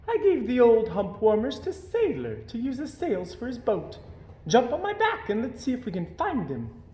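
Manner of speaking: a camel pleased with her hump warmers; chatty camel. A narrator's reading. Camel voiced.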